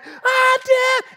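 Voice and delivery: put-on very high, loud child shriek; mock shriek at dad; screaming daughter. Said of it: "now she's hitting Dad".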